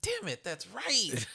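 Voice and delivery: high, laughing concession; laughing admission. Retold or imitated. Mother caught out by her son, high and laughing. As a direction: laugh as you admit defeat.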